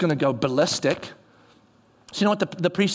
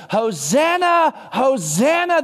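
calling out Hosanna; 5 semitones higher, much slower than usual. Retold or imitated. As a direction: cry out Hosanna slowly like a crowd. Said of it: "they use this word".

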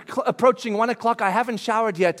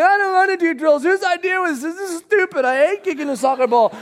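put-on higher, complaining child voice; whiny kid; 8 semitones higher, 4 dB louder than usual. Kid refusing soccer drills: high whine. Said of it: "And it's like".

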